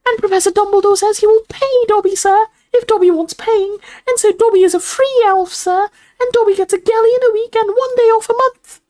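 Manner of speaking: very high, loud elf squeak; proud house elf. A narrator's reading. Dobby describes his wages, proud squeak. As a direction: pipe the terms out proudly as an elf.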